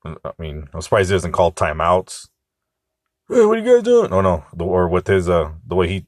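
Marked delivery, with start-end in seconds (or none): 3.3-4.1 high-pitched mock yell at the players. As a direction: squeal it like a meddling sideline fan.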